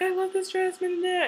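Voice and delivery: high, whiny imitation of the crying bride; mock crying daughter. Mocking the crying bride on TV, high and whiny. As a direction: whimper it like a weepy bride. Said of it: "the daughter was crying, and she's like".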